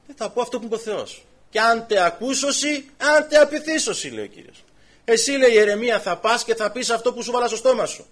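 preacher lifts his voice to the crowd over Jeremiah's call; full-force preaching. Acted. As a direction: thunder it out to the congregation.

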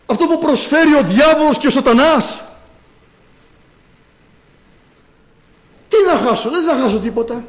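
ironic, carefree churchgoer brushing off sin; mocking careless believer. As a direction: toss it off like nothing matters, half laughing.

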